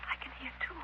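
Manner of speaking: hushed. Acted. Hushed while hearing telepathic voice.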